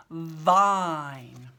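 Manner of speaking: Vine with a firm buzzing V, teeth on lip; correct vine. Shown as a demonstration. Teacher modelling the right vine. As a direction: give Vine a strong clear V. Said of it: "it sounds wrong".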